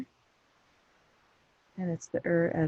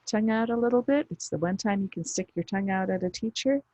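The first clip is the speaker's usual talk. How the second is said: ordinary talk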